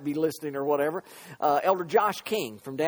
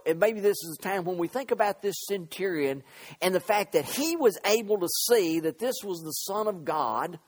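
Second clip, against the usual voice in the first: normal talking voice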